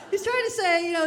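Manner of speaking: ordinary delivery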